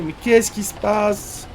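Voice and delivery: high, slow, hammed-up panicked wail; mock panic. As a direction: play up the fright, drawn out. Streamer reacts in panic.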